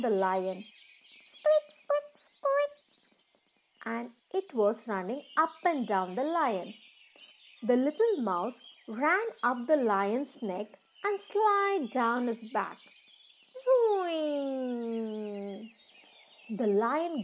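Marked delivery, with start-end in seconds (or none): 1.3-2.7 high squeaky mouse squeaks. Acted. Narrator squeaking as the playful mouse. 13.9-15.7 zoing. Acted. Mouse slides down lion's back.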